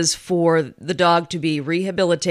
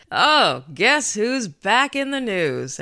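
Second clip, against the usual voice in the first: slow, drawn-out sarcasm, pitched up; sarcastic; 5 semitones higher, slower than usual. Real, not acted. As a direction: announce it with drawn-out mock excitement. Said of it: "you can probably hear the sarcasm".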